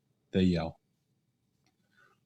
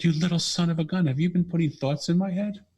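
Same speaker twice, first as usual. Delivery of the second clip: delighted surprise; 9 semitones higher than usual. Retold or imitated. Father realizes daughter's telepathy game.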